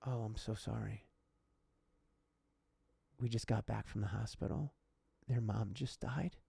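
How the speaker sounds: soft, understated apology, voicing a bereaved dad; subdued grieving father. Storyteller as widowed dad apologizing to annoyed lady, hushed and subdued. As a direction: say it quietly, with long pauses, as the stunned father.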